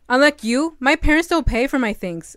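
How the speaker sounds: loud, proud and angry; angry retort. Acted. Girl answers rich bully, loud and angry. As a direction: hit back hard at the bully.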